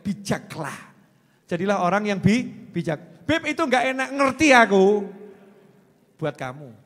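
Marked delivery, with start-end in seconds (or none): 3.2-5.1 high, loud mock protest; put-on voice of a woman objecting, building louder; imitated complaining woman. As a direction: play an indignant questioner.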